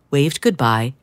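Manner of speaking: usual speaking voice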